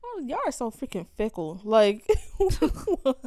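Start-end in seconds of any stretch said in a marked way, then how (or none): 1.6-3.2 laughing disbelief. Real, not acted. Incredulous laugh at characters.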